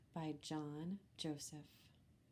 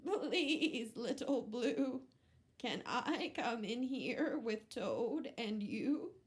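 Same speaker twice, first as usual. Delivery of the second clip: higher, louder little goat asking to come in; pleading goat; 4 semitones higher, 4 dB louder than usual. Acted. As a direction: beg to be let in like a small goat. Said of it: "said Goat".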